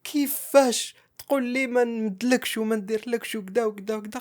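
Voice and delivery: wife shouting her protest at her husband; mock upset shouting. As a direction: act out her indignant outburst, lightly. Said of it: "وبدات تعيط".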